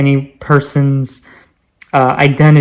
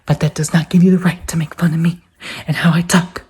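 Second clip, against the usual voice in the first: breathy, half-whispered reproach; whispery rebuke; 4 dB quieter than usual. Acted. Speaker tells mockers off, whispery rebuke. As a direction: drop into a whisper to make the point. Mock-solemn rebuke of bullies.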